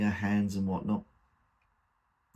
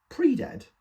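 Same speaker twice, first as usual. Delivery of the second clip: incredulous echo; 5 dB louder than usual. Acted. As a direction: pitch leaps up, louder, in disbelief. Son echoes her word in disbelief.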